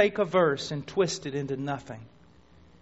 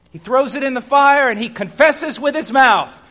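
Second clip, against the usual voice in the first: loud, building sermon story; preaching at full force; 7 semitones higher, 12 dB louder, slower than usual. Acted. Preacher to congregation, loud and building. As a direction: drive the story home with rising force.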